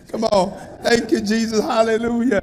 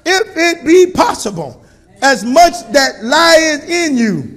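loud scripture reading; 3 semitones higher, 5 dB louder, slower than usual. Shown as a demonstration. Preacher proclaiming the verse to the congregation, loud and slow. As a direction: call out "If it be possible" slowly with full force. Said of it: "Verse eighteen said what?".

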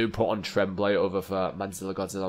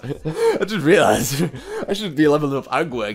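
laughing; 7 semitones higher, 8 dB louder than usual. Real, not acted. Laughs at a sudden idea.

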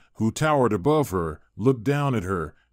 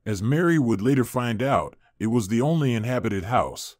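ordinary delivery, nothing marked

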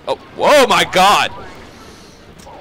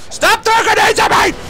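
yelling in protest; 12 semitones higher than usual. Real, not acted. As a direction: yell it at the player spamming grenades. Under grenade fire, yelling at enemy.

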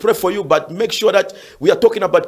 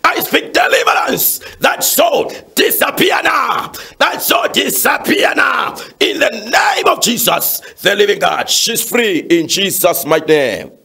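forceful healing prayer; 4 semitones higher than usual. Real, not acted. Pastor praying healing for caller's wife, fervent commanding prayer. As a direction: command the sickness out, stretching the key words.

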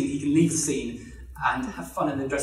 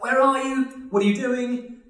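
high, louder mock demand; exasperated wife; 8 semitones higher, 3 dB louder than usual. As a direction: imitate a wife calling after her missing husband. Wife confronts missing husband.

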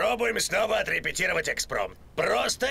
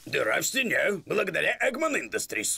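fast ad read; 3 semitones lower, faster than usual. Acted. Sponsor plug read on air.

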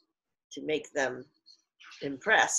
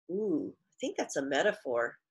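usual speaking voice

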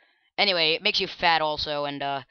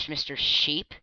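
ordinary talk

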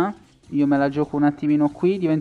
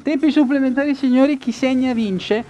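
announcer voice; 6 semitones higher, 4 dB louder than usual. Pretend. Announcing overtime to viewers.

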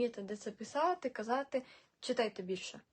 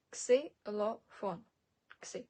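Ксилофон broken slowly into three parts; syllable by syllable; much slower than usual. Shown as a demonstration. Teacher modelling for learners, Ксилофон slowly by syllable. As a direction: sound out Ксилофон with gaps between syllables.

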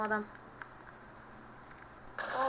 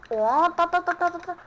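high, loud staccato ta-ta-ta; alarmed shout; 7 semitones higher, 10 dB louder than usual. Real, not acted. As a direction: yelp as the wheel slips. Tekerlek kayarken panik. (yüksek ve yüksek sesli telaşlı "ta ta ta")

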